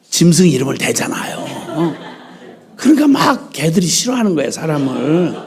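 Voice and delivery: usual speaking voice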